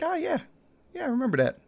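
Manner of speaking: high, soft, airy mock-naive voice; lightly voiced nostalgic dupe; mock clueless. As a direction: play someone who thinks it was yesterday. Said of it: "you're like".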